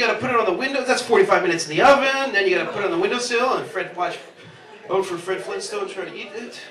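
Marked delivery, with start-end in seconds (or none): none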